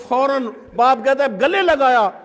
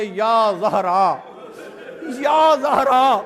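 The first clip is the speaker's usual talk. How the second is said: drawn-out calling; much slower than usual. Retold or imitated. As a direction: call her name slowly, each cry stretched. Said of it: "اپنی توجہ کے ساتھ آواز دے".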